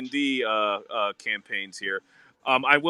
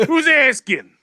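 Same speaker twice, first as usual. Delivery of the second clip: high, loud suspicious challenge; hammed-up demand to know who wants them; put-on character voice; 11 semitones higher, 5 dB louder than usual. Repeats the character bit.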